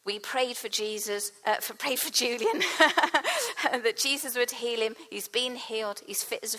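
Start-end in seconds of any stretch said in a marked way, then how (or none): 2.6-3.7 quick bright chuckle; a real burst of laughter; short laugh. Real, not acted. Preacher laughing mid-story, a short chuckle.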